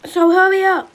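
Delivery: loud, pushy hurry up; impatient shout. Real, not acted. Big sister yelling at sibling to hurry, loud. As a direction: yell at her to hurry. Siblings rushing each other out.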